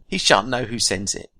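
ordinary talk

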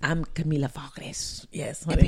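whispered raspy impression, very slow; hushed raspy character voice; Camila Vargas. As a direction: mimic her in a low, slow whisper. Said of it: "with her little raspy voice".

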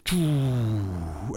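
a low "choo" sliding down like a bass drop; imitated bass slide. Presenter imitating The Chase sound effect for listeners, a low sliding choo. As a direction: mimic the game show's bass effect sweeping down. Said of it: "you can hear it going".

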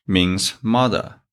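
ordinary talk

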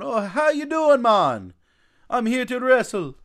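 high, loud mock Jamaican accent; loud put-on Jamaican accent. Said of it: "he was full-blown Jamaican".

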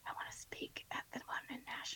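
a real whispered confession. Real, not acted. Admitting to the host she wants to speak at Nashville, whispered. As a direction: whisper it so it could be cut out. Confiding aside, "you might cut this out.

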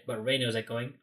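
usual speaking voice

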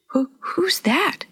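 slow, breathy, high stammer. A narrator's reading. Girl sees a stranger on the bank, breathy stammer. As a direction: stumble on the first word in a half-whisper. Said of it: "Jenny stammered".

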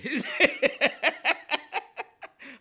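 long, high, loud laugh; laughter. Real, not acted. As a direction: burst out cackling mid-show. Laughs after promising to get into it.

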